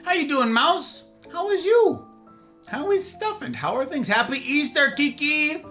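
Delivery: high squeaky welcome; high excited greeting. Real, not acted. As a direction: squeak your hellos.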